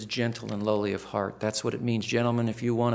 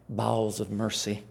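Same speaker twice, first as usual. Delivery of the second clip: usual speaking voice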